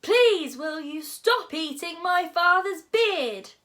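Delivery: very loud, high, stretched-out plea; yelling at full volume. A narrator's reading. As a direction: yell the polite plea with all your might. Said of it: "Hiccup yelled as loudly as he could".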